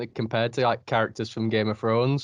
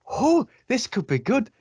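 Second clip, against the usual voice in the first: imitating hopeful excitement; 9 semitones higher than usual. Recalling hope for new Star Wars.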